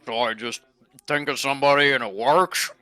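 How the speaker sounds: loud, awkward put-on voice; badly chosen character voice, loud; trying on a voice. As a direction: test out a clumsy new voice loudly. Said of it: "Maybe pick a better voice".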